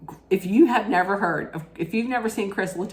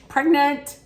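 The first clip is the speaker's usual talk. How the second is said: "Pregnant" much higher and louder, drawn out; exaggerated swooning "Pregnant"; 9 semitones higher, 5 dB louder than usual. Reacting to the French accent, a much higher, louder "Pregnant". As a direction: gush the word like the accent overwhelms her. Says word twice, second marked.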